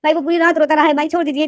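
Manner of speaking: desperate weepy pleading; crying plea. A narrator's reading. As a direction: plead through tears with a big brother. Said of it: "तो लेनदेन जो यही बोलता".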